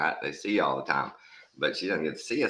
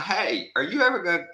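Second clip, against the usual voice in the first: mildly high self-quote; 8 semitones higher, faster than usual. Retold or imitated.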